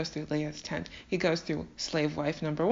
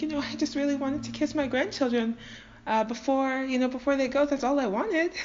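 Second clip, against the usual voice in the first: higher, hammed-up plaintive plea; overly dramatic; 5 semitones higher than usual. Laban begging to kiss his grandchildren, overly dramatic. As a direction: plead it high and theatrical. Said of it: "Laban is overly dramatic".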